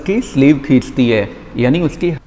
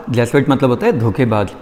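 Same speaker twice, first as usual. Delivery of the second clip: ordinary delivery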